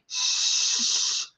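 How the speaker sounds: voiceless shh then the name; shush. Shown as a demonstration. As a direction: hush the crowd, then Trish. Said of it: "t-shirts that say".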